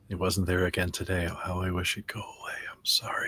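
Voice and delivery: low, soft recital; quiet muttering to self. Acted. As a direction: recite it low and softly, fading away. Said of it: "probably just sitting there and saying".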